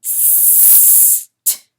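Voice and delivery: a frozen, voiceless psst through stiff lips; stunned coyote hissing psst to his wife; whispered psst. Acted. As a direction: hiss a tiny psst, barely moving. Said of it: "all he can say in his freeze".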